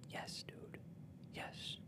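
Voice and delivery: hushed, breath-only self-approval; whispered. Real, not acted. Podcaster cheering himself on after singing, whispering. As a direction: whisper it, savouring each yes.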